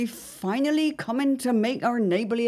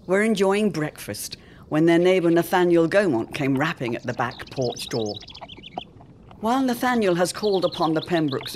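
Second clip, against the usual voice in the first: measured radio-serial recap narration; dramatic narrator; 5 semitones lower than usual. A narrator's reading. Narrator recapping the Pembrokes' story for listeners, ominous. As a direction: set up the danger like an old radio announcer.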